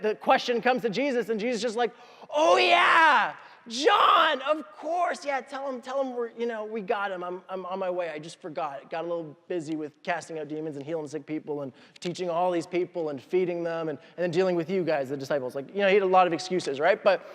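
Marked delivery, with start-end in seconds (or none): 2.3-5.2 high, drawn-out mock realization; hammed-up "oh yeah" pitched way up; sudden remembering. Jesus suddenly remembers John, acted. 5.3-15.6 soft, sinking mock excuse-making; acted offhand, quieter brush-off; sheepish excuses.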